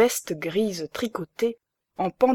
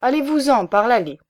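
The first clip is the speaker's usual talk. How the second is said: louder, higher order to leave; shouting; 5 semitones higher, 5 dB louder than usual. A narrator's reading. Baron ordering the stove-fitter out, loud and higher. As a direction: bark him out the back way. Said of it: "cria-t-il au fumiste".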